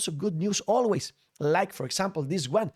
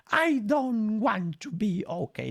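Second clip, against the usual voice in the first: higher, pouty refusal; higher sulky pout; 6 semitones higher than usual. Retold or imitated. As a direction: pout back at the comforter. Sulky reply in voiced argument.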